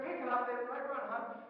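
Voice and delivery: high, loud coaching yell; training mode shout. Retold or imitated. Trainer shouting Ray through his last minutes. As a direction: drive him home like a corner trainer. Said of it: "I break into training mode".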